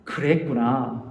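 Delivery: 그랬구나 low, soft and slow, falling gently; right way. Shown as a demonstration. Pastor shows congregation the right empathic way, soft and slow. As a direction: ease 그랬구나 down softly and slowly. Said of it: "이게 아니라".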